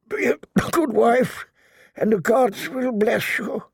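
high, strained, halting delirium; dying old lord. Acted. Delirious father blesses the woman clutching his hand, pained. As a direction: push each phrase out through pain.

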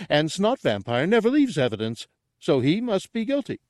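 high simpleton sleuth; high-pitched, pleased character voice; proud deduction. Acted. Gartog concludes his absurd deduction.

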